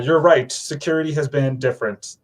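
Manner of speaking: ordinary talk, nothing marked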